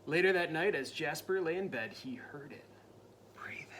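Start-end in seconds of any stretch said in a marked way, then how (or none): 1.9-3.7 hushed suspense whisper. A narrator's reading. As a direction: whisper as if listening. Suspense narration.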